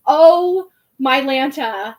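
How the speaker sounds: loud, drawn-out "oh" of realization; stunned realization. Retold or imitated. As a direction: let it dawn on you, loudly and slowly. Retelling her stunned realization.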